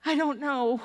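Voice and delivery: imitating a scared player's small answer; timid answer. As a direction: say it like a frozen kid. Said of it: "like that".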